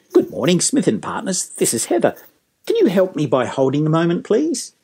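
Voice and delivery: friendly, lilting receptionist greeting; courteous, melodic phone manner; willing to help. Shown as a demonstration. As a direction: sound glad to help, lifting up on please. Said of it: "That's a very different response".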